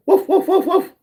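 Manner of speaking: high, silly yapping woofs, imitating a puppy; dog barking. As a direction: bark like a playful little puppy. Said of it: "You can pretend to be a puppy dog".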